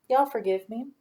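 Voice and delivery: ordinary talk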